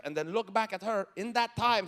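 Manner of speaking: normal talking voice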